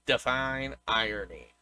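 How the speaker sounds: a light, soft, dry take on Steve Buscemi's character; deadpan it like Buscemi's convict; imitating Steve Buscemi's character. Fan quotes a favorite line to a co-host, soft and dry. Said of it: "in his voice was hilarious".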